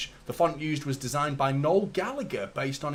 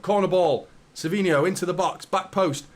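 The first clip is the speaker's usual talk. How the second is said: live play-by-play; 6 semitones higher, 5 dB louder than usual. Acted. Live commentary on the match.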